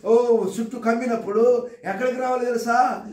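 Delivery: rising, ringing calls of praise the crowd answers; fiery preaching. Acted. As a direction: preach it at full force, building each line.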